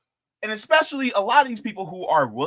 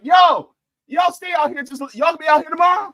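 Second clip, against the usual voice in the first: higher, louder hollering; mock shout to protesters; 11 semitones higher, 7 dB louder than usual. Thanking protesters for a day off, loud hollering. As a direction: yell it across the street, cheering them on. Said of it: "I be like".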